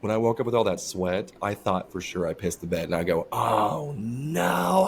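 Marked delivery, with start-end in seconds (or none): 3.3-4.9 drawn-out, high "oh no"; retold dismay. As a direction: act out a sinking realization, pitch climbing. Acting out his dismayed reaction on waking.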